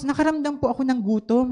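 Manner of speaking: usual speaking voice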